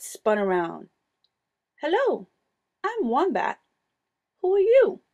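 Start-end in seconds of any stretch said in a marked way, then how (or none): 1.8-5.0 high, bright little creature voice; young Wombat voice. A narrator's reading. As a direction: lift it up high and eager.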